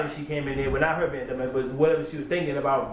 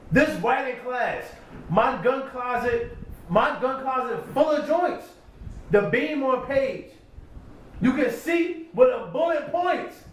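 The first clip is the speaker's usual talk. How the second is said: slow, higher, drawn-out rap delivery; reciting a battle bar; 8 semitones higher, slower than usual. Retold or imitated. Rereading the verse, slow rap delivery. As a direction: lay each bar out slowly for effect.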